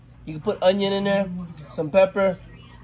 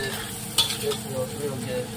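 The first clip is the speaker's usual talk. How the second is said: soft, slow murmur of pleasure, softer and lower; savoring murmur; 7 semitones lower, 8 dB quieter, much slower than usual. Real, not acted. Cook savoring the sauce smell, soft slow murmur. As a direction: breathe it out like the smell got you.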